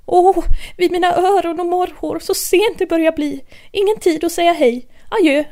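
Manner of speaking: high, loud, hurried rabbit cry; a fretful high rabbit calling out. Acted. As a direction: call out like a frantic late rabbit.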